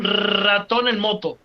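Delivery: long trilled R starting "ratón"; rolled R. Shown as a demonstration. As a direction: rev the R of "ratón" like a motorbike. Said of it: "La R".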